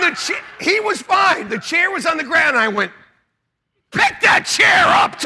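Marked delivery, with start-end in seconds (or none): 3.9-5.3 high, slow, full-volume mock command; shouted order. Dad ordering son after the fall, shouted order. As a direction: yell it with every word hammered. Father yelling order, acted.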